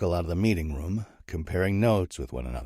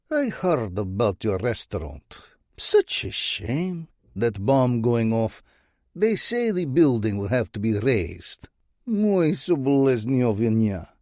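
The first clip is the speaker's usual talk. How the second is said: put-on higher, louder, slow gloating Russian accent; mocking Russian accent; 5 semitones higher, 4 dB louder than usual. Bratva boss gloating over the bombed restaurant, mocking Russian accent. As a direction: fake Russian sympathy, dripping with mockery. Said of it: "a voice laced with a Russian accent".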